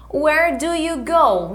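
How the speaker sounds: slow, clear teacher model; slow repeat-after-me. Shown as a demonstration. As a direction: model it slowly for the class.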